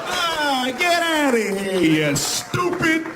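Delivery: high, slow, sneering put-down; talking to stupid people. Retold or imitated. People to someone they think stupid, high slow sneering taunt. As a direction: talk down to someone you think is an idiot. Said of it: "This is how people talk to stupid people".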